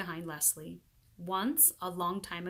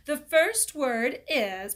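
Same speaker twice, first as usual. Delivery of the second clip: slow teacher voice; 6 semitones higher, 8 dB louder, much slower than usual. A narrator's reading. Teacher starts spelling test.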